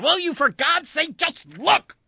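very high, loud desperate demand; enraged yell. A narrator's reading. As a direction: lose all patience, yelling. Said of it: "blinding rage he felt".

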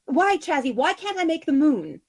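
high, eager fairy-queen voice; a bright, wistful spider queen longing for the moon; Sisera's voice. Acted. Fairy queen to her wife, dreamy and high.

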